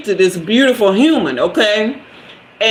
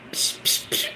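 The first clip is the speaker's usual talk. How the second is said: ordinary talk, nothing marked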